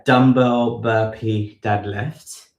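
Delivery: normal talking voice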